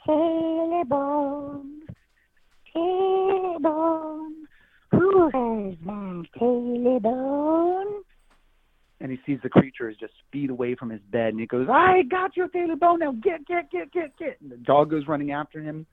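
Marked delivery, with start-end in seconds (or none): 0.1-8.0 high, slow, drawn-out ghostly wail; haunting voice. Acted. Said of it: "he hears". 11.7-14.4 high, loud, panicked shooing; scared hermit yelling at a monster; frightened old man. Acted.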